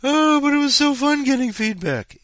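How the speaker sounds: high, loud mocking whine; mocking the readers who miss the feedback; whiny mock voice. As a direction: mimic them in a high whiny voice. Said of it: "you people are probably gonna go like".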